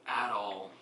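ordinary talk, nothing marked

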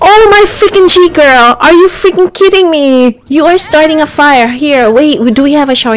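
high, loud panicked scolding; alarmed yelling. Real, not acted. Player yelling at the sim who started a fire, panicked. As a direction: shriek in exasperated alarm.

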